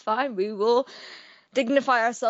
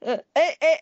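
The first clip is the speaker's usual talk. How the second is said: ordinary delivery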